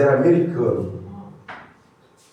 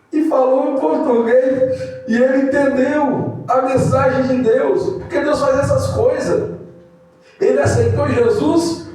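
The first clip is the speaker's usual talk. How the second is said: fervent preaching; 9 semitones higher than usual. Acted. Preacher exalting God's work before the congregation, fervent. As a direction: lift it up and proclaim God's wonder. Preacher rises to full force.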